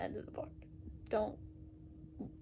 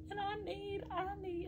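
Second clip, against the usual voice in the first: ordinary talk, nothing marked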